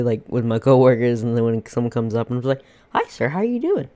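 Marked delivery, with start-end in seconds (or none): 2.9-3.9 high, sugary retail greeting; customer service voice. Retold or imitated. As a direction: put on the polite cashier voice. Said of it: "I love using the customer service voice".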